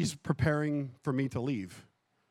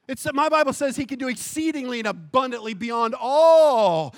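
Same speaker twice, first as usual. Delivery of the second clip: loud, raised pulpit voice; fervent preaching; 7 semitones higher, 8 dB louder than usual. Acted. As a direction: press the congregation with urgent conviction. Preaches at full force.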